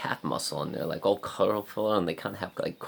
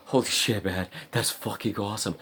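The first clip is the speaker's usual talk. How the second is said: higher-pitched, awed exclamation; retold delight; 4 semitones higher than usual. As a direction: marvel at it, voice lifted.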